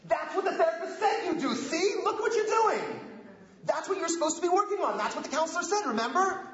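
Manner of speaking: mock blamer accusing. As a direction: play the smug accusing spouse, half-laughing. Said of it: "what am I gonna say?".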